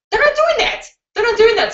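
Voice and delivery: insist, pitch shooting up; high insistent no; emphatic. Real, not acted. Emphatic rebuttal.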